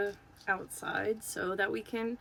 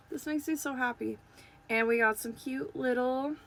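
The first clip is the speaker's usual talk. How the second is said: usual speaking voice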